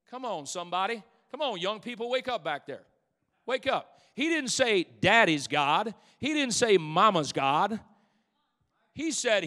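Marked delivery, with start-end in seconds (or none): 1.6-9.4 loud, punched preacher cadence; shouted pulpit cadence; preaching at full force. Acted. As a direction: hammer each line to the congregation.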